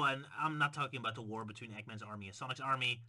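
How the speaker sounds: ordinary talk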